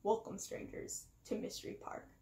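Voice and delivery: creepy unvoiced whisper of a villain; menacing whispered greeting; scary villain voice. A narrator's reading. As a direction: hiss a sinister welcome. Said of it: "a scary voice rang out".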